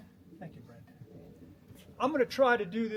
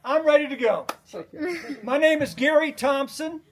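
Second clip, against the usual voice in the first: nervous loud start; 7 semitones higher, 8 dB louder than usual. Real, not acted. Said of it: "I'm suddenly real nervous right now".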